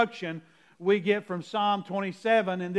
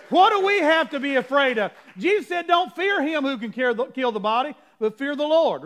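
higher, louder fervent preaching; preaching at full force; 3 semitones higher, 3 dB louder than usual. Real, not acted. Preacher pressing the church to fear God. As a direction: preach it with fire.

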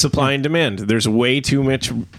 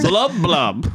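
bubble noise; 4 semitones higher than usual. Retold or imitated. Mimics underwater bubbles.

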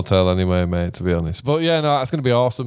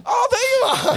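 very high, laughing "Oh, there you are"; finally spot the tiny man, giggling; mock discovery. Host spots short Pete at last, high laughing mock surprise. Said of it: "I'll be like".

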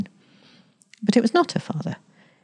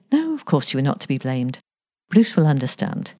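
ordinary delivery

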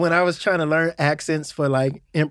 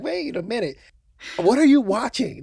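puzzled audience; 6 semitones higher than usual. Retold or imitated. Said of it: "people gonna be like".

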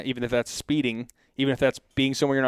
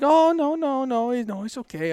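high-pitched mock panic of the officers; panicked backpedaling; 12 semitones higher than usual. Officers react to the sobbing wife, imitated panic. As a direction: imitate cops hurriedly soothing someone. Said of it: "the panic shifted to them".

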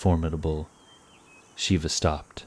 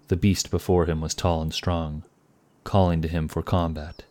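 ordinary delivery

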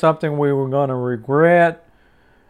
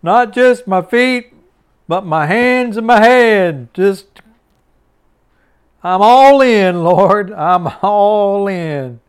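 higher and louder, wholehearted; eager all-in Peter; 5 semitones higher, 4 dB louder, slower than usual. Acted. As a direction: throw everything in, overflowing zeal. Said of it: "He said".

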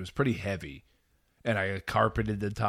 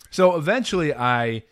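ordinary talk, nothing marked

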